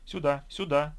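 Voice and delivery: calling loudly from ahead. A narrator's reading. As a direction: call them over, eager. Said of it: "звал Евгений".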